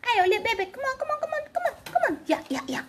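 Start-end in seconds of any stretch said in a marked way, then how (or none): 0.7-2.1 high sing-song come on, come on; high repeated coaxing to the pet. Real, not acted. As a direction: lure the dog with a high sweet voice. Coaxing the dog.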